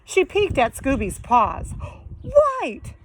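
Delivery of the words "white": excited exclamation. Acted. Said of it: "she said".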